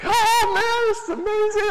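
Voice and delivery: high, far-off mock amazed voice; amazed gush. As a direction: squeal it like a stunned kid overwhelmed with joy. Siblings' amazed reaction retold.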